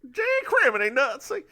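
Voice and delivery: high cartoon sheriff voice; sheriff of Nottingham impression. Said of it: "That's about the best I got".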